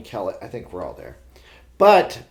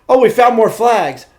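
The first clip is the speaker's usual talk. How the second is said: loud, higher-pitched triumphant exclamation; excited reenactment; 7 semitones higher, 8 dB louder, much slower than usual. Retold or imitated. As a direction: relive the lucky win with punchy excitement. Reliving getting the last flag.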